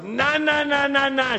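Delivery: high, drawn-out nah-nah rant; imitated irate lady. Angry neighbor objecting to his praying, high mock protest. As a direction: mimic her high-pitched objecting. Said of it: "all of a sudden she goes".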